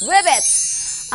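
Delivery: high, croaky frog call; croaking frog. Acted.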